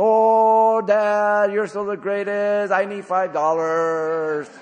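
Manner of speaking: high, loud, singsong begging to Dad; wheedling son. Retold or imitated. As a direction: sing the flattery to squeeze out money. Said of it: "trembling voice, vibrasonic".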